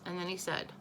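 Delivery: ordinary delivery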